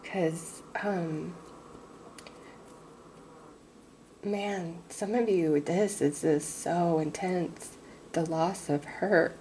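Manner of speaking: fighting back tears, barely holding it together; real emotion, struggling. Real, not acted. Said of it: "I am struggling".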